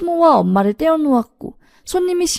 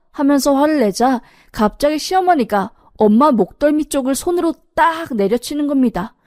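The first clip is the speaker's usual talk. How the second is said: usual speaking voice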